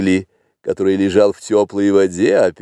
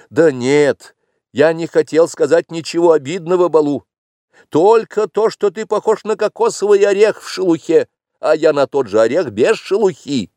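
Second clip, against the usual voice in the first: put-on excited teasing boy; 10 semitones higher, 4 dB louder than usual.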